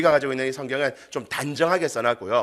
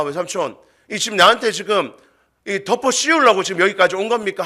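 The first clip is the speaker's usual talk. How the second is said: raised, higher voice, acted outrage; indignant confrontation; 6 semitones higher, 4 dB louder than usual. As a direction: challenge your accuser in disbelief. Acting Jacob confronting his uncle. (억울해서 따져 묻는 말투)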